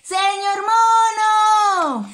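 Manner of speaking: llamada alta y cantada, muy alargada. Acted. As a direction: canta su nombre hacia lo lejos. Llamando al mono. (los animales llaman al señor mono cantando fuerte)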